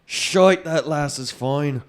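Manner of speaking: loud, high Scottish exclamation; mock Scottish accent. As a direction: shout it admiringly in a put-on Scottish accent.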